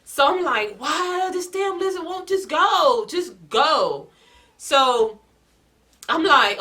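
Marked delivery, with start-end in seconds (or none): 0.8-4.1 slow and higher, fed up with the lizard; exasperated plea. Retold or imitated. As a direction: drag it out, at the end of your rope. Begging the lizard to leave.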